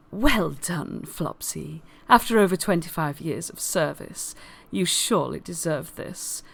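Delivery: low, soft and measured; quietly honour a long-serving performer; solemn praise. Acted. Mister Witch praising Flopsy after years of service, solemn.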